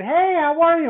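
high, loud greeting called across the yard; calling out. Retold or imitated. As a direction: call hello to someone far off at the gate. Calling out to friend at gate.

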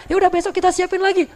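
high, eager voice of a delighted wife; happy Khadijah. Retold or imitated. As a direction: lift the pitch high, cheerfully willing. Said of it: "Khadijah bahagia banget". (suara istri yang bahagia banget, bernada tinggi)

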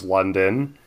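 ordinary talk

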